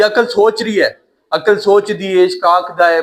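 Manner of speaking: ordinary talk, nothing marked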